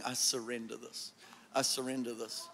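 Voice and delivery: low, hushed murmur of a prayer; quiet surrender. Acted. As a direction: say it softly, as a private yielding. Models a private act of surrender.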